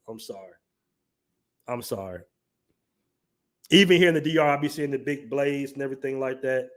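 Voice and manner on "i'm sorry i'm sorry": much softer, lower mock sorry; quiet mock apology. Real, not acted. After roasting knife robberies, quiet mock apology. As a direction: drop the voice for a fake apology.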